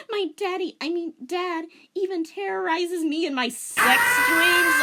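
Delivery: Rachel voiced way up high and loud; squeaky distressed teen girl confiding; high girlish voice. Reading Rachel confessing to Denmark, high and upset.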